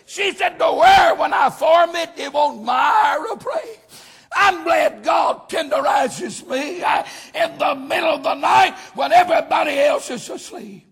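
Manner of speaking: fired-up Southern pulpit shout; full-force preaching. Real, not acted. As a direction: preach it out to the whole church.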